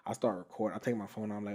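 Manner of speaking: ordinary delivery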